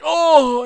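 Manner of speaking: high, loud yelp of pain; a startled cry as if just struck. Retold or imitated. Hit in the nuts, he yelps a high loud oh.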